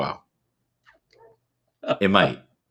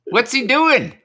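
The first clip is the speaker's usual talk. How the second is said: lightly voiced bewildered guest, higher; mock puzzled voice, pitched up; imitating a baffled guest; 10 semitones higher than usual. Voicing what the guest thinks of him, mock baffled. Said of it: "you know what he's saying right now".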